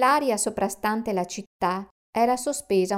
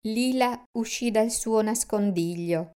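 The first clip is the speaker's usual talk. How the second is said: usual speaking voice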